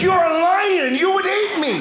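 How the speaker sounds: high-pitched, exasperated outburst; exasperated protest. Retold or imitated. Argument with wife over animals.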